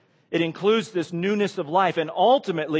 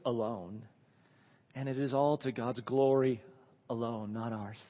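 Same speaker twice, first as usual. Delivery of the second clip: quiet, measured, each alone weighted; stress on alone; 3 semitones lower, 7 dB quieter than usual. Shown as a demonstration. Preacher repeating the solas so alone rings out. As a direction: repeat it softly, pausing on alone. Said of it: "I say it like that".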